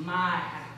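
MY drawn out slowly, low, with weight; stress on MY. Retold or imitated. Preacher quoting the demon claiming ownership, stress on "my". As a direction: lean possessively on "my". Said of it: "notice the assumption of ownership here".

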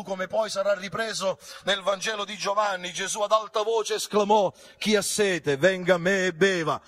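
high, loud fervent preaching; preaching at full force. Acted. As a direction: lift the congregation to Jesus with full voice.

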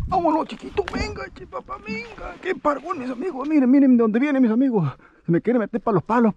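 very high, thrilled cry at the big fish; excited squeal. Real, not acted. Fisherman squeals at the big fish fighting toward the boat.

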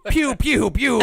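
high, loud pew-pew blasts; laser pew sounds. Pretend. A man playing the bootleg space hero fires lasers with mouth noises. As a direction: shoot toy lasers with your mouth.

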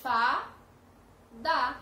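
"Fada" in two loud, separate beats, Fa, da; split into syllables. Shown as a demonstration. Teacher having children count the parts, "Fada" split Fa, da. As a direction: pause clearly inside "Fada", after Fa. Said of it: "Quantas vezes eu pronunciei?".

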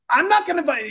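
whiny high-pitched protest. Retold or imitated. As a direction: cut off mid-protest in a high voice. Arguing with wife, protesting.

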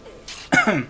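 rough throat clearing; a dry cough to clear the throat; chesty cough. Real, not acted. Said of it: "przepraszam za ten kaszel".

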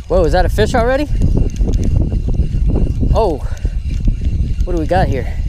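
usual speaking voice

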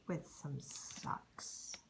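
breathy whisper, slow and dry; whispered punchline. A narrator's reading. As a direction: drop it as a hushed joke.

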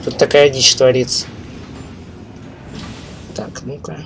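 ordinary delivery

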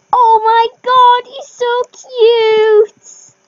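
loud, high, long-drawn squeal; overjoyed cooing at full volume; gushing. Real, not acted. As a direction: draw every word out in loud adoration. Gushing over the new horse.

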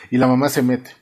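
ordinary delivery

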